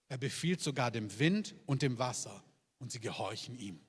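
hushed scripture reading from the pulpit. Acted. Reads Bible passage slowly.

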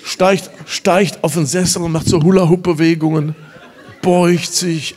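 loud, high preaching to the crowd; full-force preaching. Acted. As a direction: rouse the congregation. Excited retelling of healing.

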